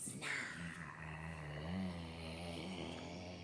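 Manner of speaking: long, low, quiet rasping snarl; leopard snarl. Shown as a demonstration. Reader testing a leopard sound on children, snarling. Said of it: "You think that sounds like a leopard?".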